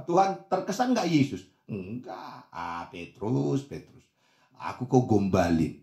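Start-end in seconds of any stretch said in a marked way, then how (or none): 1.7-5.8 unimpressed. Acted. Jesus unimpressed by Peter's boast. (menepis pelan dengan nada rendah, tak terkesan)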